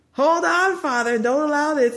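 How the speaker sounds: high, loud pleading call; a loud, high cry of hold on; urgent plea. Acted. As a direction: beg the father to stop it. Reads out the spirit's plea.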